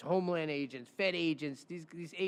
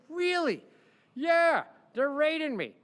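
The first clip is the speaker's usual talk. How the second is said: high, loud really; a lawyer reacting to news of the raid; mock disbelief; 11 semitones higher, 8 dB louder than usual. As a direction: sound floored by the news.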